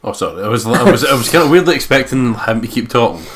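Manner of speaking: ordinary talk, nothing marked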